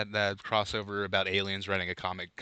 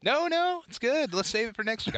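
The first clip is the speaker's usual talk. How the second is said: playful; 4 dB louder than usual. Acted. Playfully declining offer.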